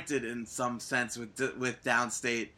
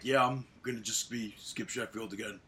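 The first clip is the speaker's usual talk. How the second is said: mumbled imitation of Ryback; 5 semitones lower, 8 dB quieter than usual. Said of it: "he's like".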